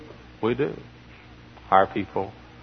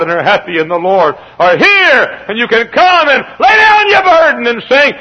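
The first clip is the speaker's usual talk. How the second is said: climactic preaching; 7 semitones higher, 9 dB louder than usual. Acted. Preacher to congregation, rising to a loud shout. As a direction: build to a fervent shout.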